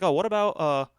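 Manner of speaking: ordinary talk, nothing marked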